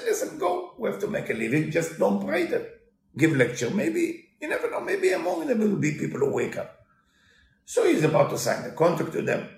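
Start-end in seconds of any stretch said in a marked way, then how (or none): 0.0-6.8 wife urging. Retold or imitated. Wife urges husband to take job.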